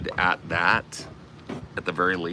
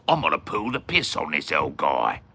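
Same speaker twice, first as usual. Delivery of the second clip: lower, put-on Aussie accent; mock Australian chatter; 5 semitones lower than usual. Streamer quoting the Australian who came to tease him, mock Aussie accent. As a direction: imitate a cocky Aussie. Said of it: "and go like".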